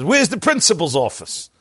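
furious father. Acted. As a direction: demand it like an outraged parent. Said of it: "the father is furious".

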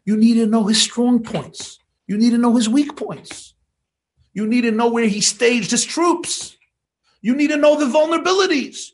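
building sermon. Acted. Rabbi urges his listeners on spying, preaching in a rising fervour. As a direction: drive each "you need to know" up like a call to arms.

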